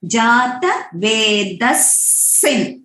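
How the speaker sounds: जातवेदस्सिन with a held double s; slow, lean on the स्स; doubled s. Shown as a demonstration. Teacher showing the extra s sound after the visarga. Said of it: "it will become".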